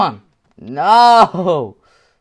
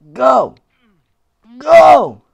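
higher, louder urging; urging shout; 6 semitones higher, 8 dB louder than usual. Real, not acted. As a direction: cheer the character on. Urging character on.

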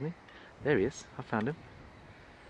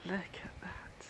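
slow hushed whisper; 9 semitones higher, 12 dB quieter, slower than usual. Real, not acted. Filming the squirrel up close, slow hushed whisper. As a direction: breathe it so the squirrel stays.

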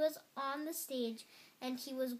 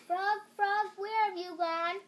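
loud, high searching cry; desperate calling; 8 semitones higher, 8 dB louder than usual. A narrator's reading. Toad alone searching for Frog, loud high cry. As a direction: call out high for a lost friend.

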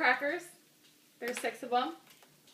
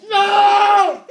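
sudden loud no; loud shout; 7 semitones higher, 13 dB louder than usual. Real, not acted. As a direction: shout it out in defeat. Loses the cracker challenge.

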